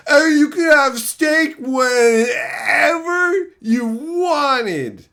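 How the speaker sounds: hammed-up sarcastic shouting impersonation; mocking chatter. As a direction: shout it like a smug know-it-all you're making fun of. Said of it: "That's you right now".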